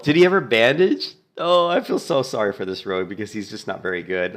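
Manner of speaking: normal talking voice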